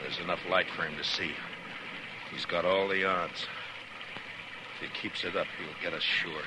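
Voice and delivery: low, soft undertone; hushed and grim. Acted. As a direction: keep it down while pinned under fire. Pinned down, hushed talk.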